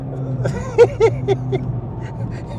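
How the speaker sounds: high airy delighted laugh; breathy giddy laugh. Real, not acted. Thrilled on track.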